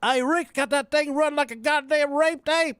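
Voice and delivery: mock the country fair guy with gusto; high, loud imitation of a country yokel at the fair; hillbilly voice. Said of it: "He says".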